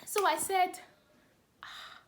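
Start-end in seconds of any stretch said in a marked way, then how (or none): none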